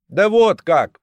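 bright, cheerful high exclamation. A narrator's reading. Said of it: "весело ответил".

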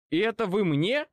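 high, affronted question; indignant. A narrator's reading. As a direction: ask it in offended disbelief. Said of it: "возмутился я". (возмущённо)

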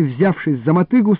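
ordinary delivery, nothing marked

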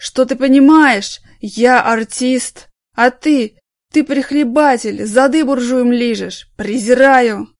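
put-on loud slow drunken tirade; drunken rage. Drunk actor raging at a smug coworker, loud. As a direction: spit the contempt out loud.

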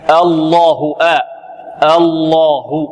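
الله with full heavy lam, open a drawn long; teacher shows الله with thick lam and long open a; correct Allah. Shown as a demonstration. Teacher models correct الله for students, heavy lam. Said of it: "هكذا".

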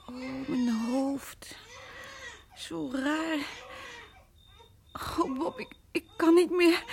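slow, faint and broken; fading, close to crying; weak near-tears. Acted. Verzwakte vrouw bijna huilend. (zwak en huilerig)

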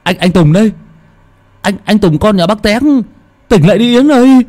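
high, loud and rushing in panic; frantic cry over an unconscious girl; panicked. Acted. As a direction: shake her awake, desperate. Finds injured Yến, panics.

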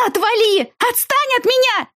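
desperate high-pitched screaming; inhuman scream. A narrator's reading. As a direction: scream it with everything you have. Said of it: "Я заорала нечеловеческим голосом".

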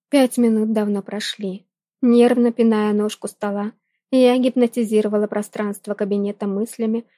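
usual speaking voice

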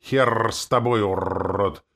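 slow, loud growled curse; growling howl. A narrator's reading. Demoness grudgingly giving in, growling. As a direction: growl it out, rolling the r hard. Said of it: "прорычала и провыла она".

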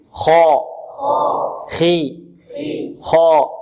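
teacher contrasts خا and خي; contrasting vowels. Shown as a demonstration. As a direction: alternate a heavy خا with a light خي. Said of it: "ننطق معها".

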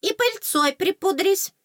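very high, slow little command; strict fairy. A narrator's reading. Fairy orders heroine to powder up, high strictness. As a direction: give a firm order in a tiny fairy voice. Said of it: "строго распорядилась оскалка".

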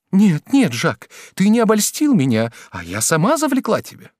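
high, insistent woman's voice; pleading woman. A narrator's reading. Woman takes blame to escape judge, pleading. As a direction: protest urgently, starting loud and high. Gervaise takes back her accusation.

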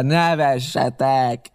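pirate jeering back at the laughter; drawn-out taunt. A narrator's reading. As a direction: drag the word out with scorn. Pirate mocking the laughing defenders.

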